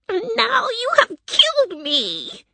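very high, slow witch wail; dying witch's cry. A narrator's reading. Witch accusing Dorothy as she melts, wailing. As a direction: wail it out, drawn long. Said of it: "the wicked witch cried".

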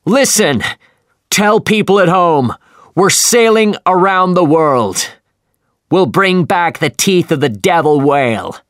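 captain shouting his message to the other ship; shouting over wind. Acted. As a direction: bellow the message across the water. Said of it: "he shouted".

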